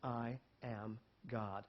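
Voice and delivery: soft, slow, sincere; slow quiet declaration. Shown as a demonstration. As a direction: say it quietly, with certainty. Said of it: "be audacious enough to say".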